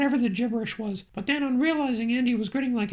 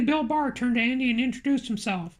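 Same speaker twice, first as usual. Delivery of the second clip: ordinary delivery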